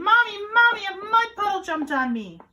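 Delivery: high, loud cry to Mommy; high tattling yell, easing down at the end; child yelling. A narrator's reading. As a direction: wail it like an upset little kid. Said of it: "Julian ran inside yelling".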